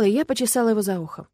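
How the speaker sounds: ordinary delivery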